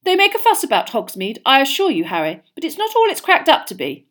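high, loud, earnest assurance; serious, self-important. A narrator's reading. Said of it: "he said seriously".

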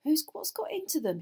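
high, baffled demand; stumbling, incredulous question. A narrator's reading. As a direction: demand to know, bewildered. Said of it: "he demanded".

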